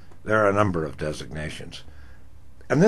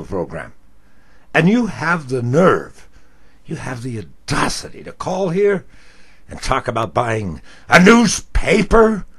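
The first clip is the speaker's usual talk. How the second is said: loud, high furious rant; angry tirade; 5 semitones higher, 8 dB louder than usual. Retold or imitated. Host ripping into the caller on air, loud and furious. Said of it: "I am angered".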